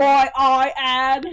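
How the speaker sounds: slow, drawn-out Tom Holland line; Tom Holland quote. Shown as a demonstration. Quoting the Tom Holland clip to her sister, slowed down. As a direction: stretch it out like the famous clip. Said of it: "that's a vocal stem of mine".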